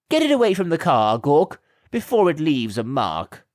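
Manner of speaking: a grand lady snapping an order; commanding. A narrator's reading. Lady orders Gawk away from her car, imperious. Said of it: "commanded the lady".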